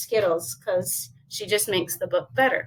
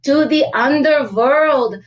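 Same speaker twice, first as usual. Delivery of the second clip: dramatic correction; 4 semitones higher, 7 dB louder than usual. Acted. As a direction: announce it grandly, word by word. Dogs announce spooky destination.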